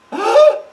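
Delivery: loud, high mock gasp. Speaker ridiculing the idea Mother was forced, mock shocked gasp.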